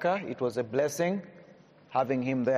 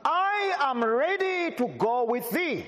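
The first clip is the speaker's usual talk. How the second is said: raised, slowed King James reading; scripture reading; 5 semitones higher than usual. Acted. Preacher reading Luke aloud to the congregation, raised and proclaiming. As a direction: proclaim the verse to the hall.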